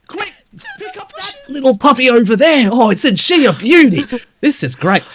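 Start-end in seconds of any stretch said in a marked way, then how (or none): 0.1-4.0 higher hammed-up Aussie accent; mock Australian. Host riffing in a hammed-up Australian accent. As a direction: play an excited outback bloke.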